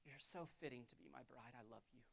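very soft, low declaration of love; low, near-whispered devotion with long pauses; tender God voice. Acted. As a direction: speak hushed and intimate to your bride. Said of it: "He's looking and going".

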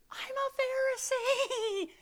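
very high, slow wail; mock horrified realisation. Reader realising their own self-righteousness, mock horrified wail. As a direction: stretch it out in a high, dawning cry. Acted shocked realisation after reading.

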